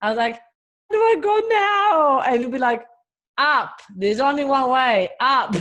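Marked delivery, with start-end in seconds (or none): 0.9-2.2 high, loud frightened cry; panicked question. Retold or imitated. Climber calls down to her trainer, high and panicky. 3.4-5.6 a hint of a firm coach, louder; trainer's answer. Trainer shouts encouragement up the wall, lightly voiced and a bit louder.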